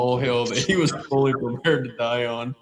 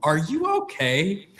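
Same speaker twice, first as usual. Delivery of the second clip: slow, higher-pitched imitation of a woman asking; mock-concerned, lilting rise at the end; imitated concerned check-in; 6 semitones higher than usual. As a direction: stretch it out as a careful question. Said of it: "She turns around and she goes".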